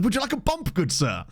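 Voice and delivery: high-pitched mock-posh offer, falling at the end; lightly hammed-up gentleman dealer. Dealer offering a stranger drugs, mock-posh. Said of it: "posh pitch for a posh drug".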